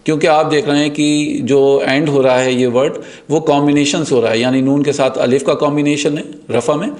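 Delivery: ordinary talk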